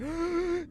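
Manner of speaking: sharp, high imitated gasp; waking gasp. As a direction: gasp as if jolted awake.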